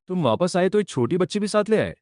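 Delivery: high-pitched, mildly annoyed reproach; miffed master. Acted. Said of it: "master को गुस्सा आ गया". (हल्की नाराज़गी भरा ऊँचा स्वर)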